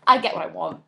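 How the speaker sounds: ordinary talk, nothing marked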